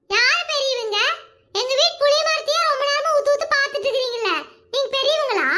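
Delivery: put-on high child's voice, brushing off flattery; be a cheeky small girl demanding answers; little granddaughter. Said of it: "இத்துணூண்டு இருந்துட்டு இந்த பேச்சு பேசுதே". (சின்னப் பிள்ளையின் கறார் குரல்)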